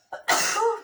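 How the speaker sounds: loud nose blow into a tissue; a quick sharp blow of the nose. Real, not acted. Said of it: "il faut que je me mouche".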